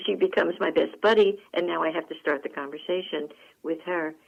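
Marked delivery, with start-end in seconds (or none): none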